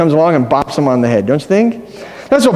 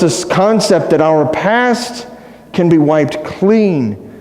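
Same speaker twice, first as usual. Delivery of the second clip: normal talking voice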